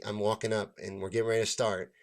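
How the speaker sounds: ordinary delivery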